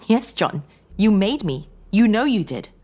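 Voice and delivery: loud, high accusing retort; wife throwing the blame back; heated insistence. Acted. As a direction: insist on it, rising in force. Wife insisting in acted quarrel.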